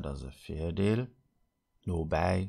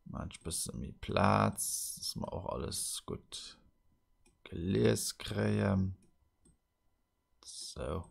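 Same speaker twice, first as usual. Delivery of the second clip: ordinary talk, nothing marked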